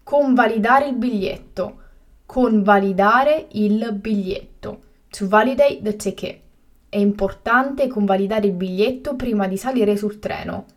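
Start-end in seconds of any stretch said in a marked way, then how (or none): 2.2-4.8 slow and carefully spaced; slow, clear repetition. Shown as a demonstration. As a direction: say it again slowly for learners. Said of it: "teacher repeats the word twice".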